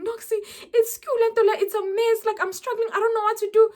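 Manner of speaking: high, fast, stressed phone plea; panicked friend. Retold or imitated. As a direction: mimic a flustered friend calling.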